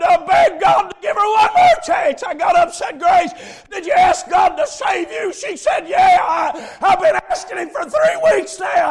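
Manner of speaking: high, loud revival preaching; full-throated, urgent sermon shout; preaching at full force. Real, not acted. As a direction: shout the warning at the sinner.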